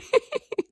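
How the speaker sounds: burst of laughter. Real, not acted. Reacting to the Alabama line.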